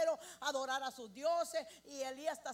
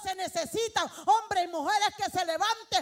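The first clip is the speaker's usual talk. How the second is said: loud, rising preacher's call to the congregation; full-force preaching; 3 semitones higher, 10 dB louder than usual. Acted. Preacher rallying the church to rebuild altars, full-force preaching. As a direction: preach it out, louder and louder.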